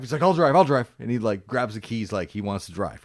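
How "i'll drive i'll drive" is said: eager volunteer. Retold or imitated. Eager guy grabbing keys.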